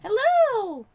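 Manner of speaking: high, loud hello; high cheerful greeting. Real, not acted.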